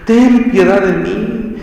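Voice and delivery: pleading cry. Retold or imitated. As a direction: beg God for pity, drawing it out. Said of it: "empezó a gritar y a llorar". (súplica lenta y llorosa, más aguda)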